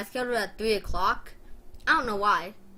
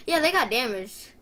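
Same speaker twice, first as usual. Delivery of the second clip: mom's blunt confirmation; 4 semitones higher, 6 dB louder than usual. Retold or imitated. Acts out mom's reply.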